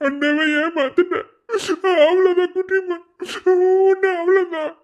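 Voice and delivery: loud anguished wail; sobbing. Acted. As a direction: break down crying out the warning. Desperate threat through tears.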